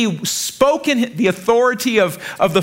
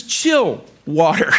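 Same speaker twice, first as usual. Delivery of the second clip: casual command; 3 semitones lower, much slower than usual. Retold or imitated. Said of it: "and he says".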